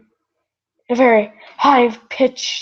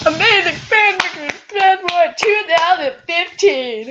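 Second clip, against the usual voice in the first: high mock voice; 7 semitones higher than usual. Mock announcer after reading.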